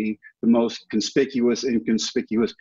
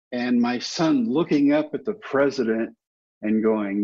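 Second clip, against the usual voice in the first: normal talking voice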